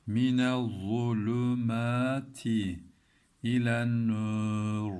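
slow, with a lisped z and a heavy r; slow tajweed reading, heavy letters held. Shown as a demonstration. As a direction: draw out Minez zulümati with a thick z and dark r. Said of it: "Nasıl okuduk?".

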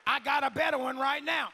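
high, shouted preaching; rousing revival-preacher shout; full-force preaching. Real, not acted. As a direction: shout it out in driving, drawn-out phrases.

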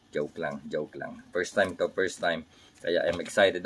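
low, soft retraction of a boast; hushed, low-pitched admission; quiet aside. Real, not acted. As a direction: drop right down to take it back. Taking back his boast as joke.

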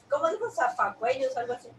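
ordinary talk

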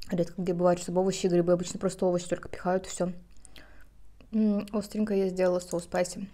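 talking between bites; chewing while talking. Real, not acted. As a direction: mouth partly full. Seen 3: Bite taken at -5; lips pursed, cheek bulge while talking after.

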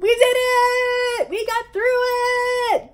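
very high, long squealed cheer; celebrating. Real, not acted. Reader finishing the book, squealed celebration. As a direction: squeal it out, every last word stretched.

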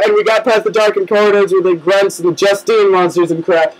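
ordinary talk, nothing marked

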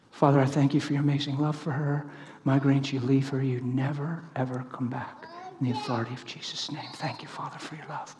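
prayer fading to a whisper; short prayer. Shown as a demonstration. Praying over a woman with migraines, fading to a whisper. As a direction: pray firmly, then whisper the thanks.

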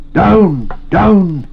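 high, loud cry of "Down!"; urgent, falling shout; gasped command. A narrator's reading. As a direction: gasp it out as a forceful order. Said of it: "he gasped".

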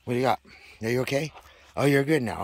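reassuring pet voice. Real, not acted. Checking the pet's foot.

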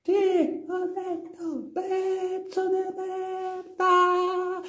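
high, soft, drawn-out repeat; too quiet to hear. Acted. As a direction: stretch it out slowly and faintly. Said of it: "Urla più forte che non ti sento". (voce flebile, acuta, molto lenta)

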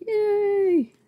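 high, drawn-out little cheer; gleeful high-pitched cheer; happy cheer. Real, not acted. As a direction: let it ring out high with delight. Cheering over her order.

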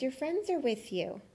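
ordinary delivery, nothing marked

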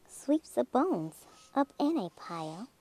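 ordinary delivery

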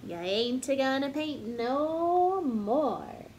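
slow, drawn-out mock holler from mama. A narrator's reading. Mama scolding the child for painting the walls, slow mock holler. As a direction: drag it out like a fed-up mama. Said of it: "I heard my mama holler".

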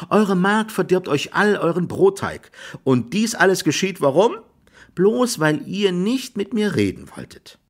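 high-pitched, pointed reproach; high, indignant summing-up; lecturing reproach. A narrator's reading. Jack lectures farmer, voice pitched high.

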